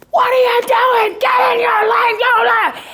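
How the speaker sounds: high, loud furious shout at another driver; road rage yell. Retold or imitated. Driver yelling at another car, high furious shout. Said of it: "I have this road rage".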